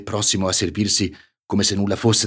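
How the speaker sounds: usual speaking voice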